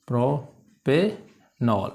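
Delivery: Propanol as three slow separated pieces, pro, पे, नॉल; syllable by syllable. Shown as a demonstration. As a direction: dictate propanol very slowly with pauses. Teacher repeats it in syllables.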